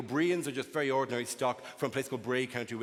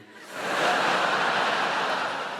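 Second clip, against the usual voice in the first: breathy laugh; 7 dB louder than usual. Real, not acted. Audience-facing laugh.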